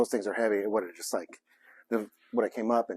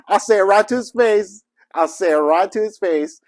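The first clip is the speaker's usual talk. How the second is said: excited boast; 7 semitones higher, 6 dB louder than usual. Real, not acted. Playful boast after cheering.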